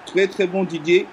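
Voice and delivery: rising excited goal call; play-by-play commentary. Acted.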